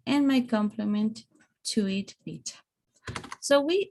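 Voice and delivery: ordinary talk, nothing marked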